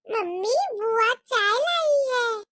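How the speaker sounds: lightly voiced little girl, slow and sing-song; a small girl calling out to her mummy, slowly; child's voice. Little girl calls her mother. (हल्की बच्ची जैसी आवाज़)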